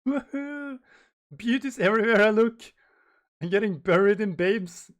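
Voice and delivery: high-pitched giddy swooning; loud, lovestruck whooping; Sanji. Acted. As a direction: gush in a high, excited voice. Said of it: "Oh, Sanji-kun, you're so silly".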